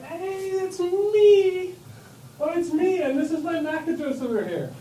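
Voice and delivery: high, excited "it's me"; eager device. Acted. Device answering the broadcast, eager and high. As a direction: jump in like a device calling out that it's the one.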